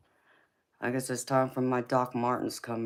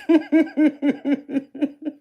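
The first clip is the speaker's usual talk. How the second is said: high bursting laugh of real amusement; loud real laugh; 11 dB louder than usual. Real, not acted. Reading a funny viewer comment.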